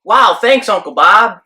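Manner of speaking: punched-up, polite fake enthusiasm; feigned gratitude. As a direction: act grateful to hide disappointment. Feigned thanks while privately disappointed.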